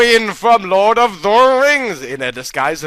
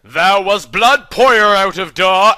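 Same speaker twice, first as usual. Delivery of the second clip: plain reading voice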